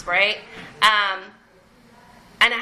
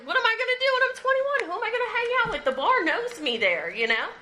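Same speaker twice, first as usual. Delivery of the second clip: mock young self; 8 semitones higher than usual. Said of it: "I was like".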